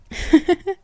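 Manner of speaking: high little mocking laugh; mocking giggle. Vampire giggling to her lover about the dead wife. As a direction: giggle at the rival's expense.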